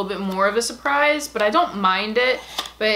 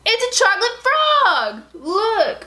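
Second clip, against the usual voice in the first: delighted squeal; 10 semitones higher, slower than usual. Real, not acted. Squealing to viewers at the chocolate frog design. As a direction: burst out with joy at the find.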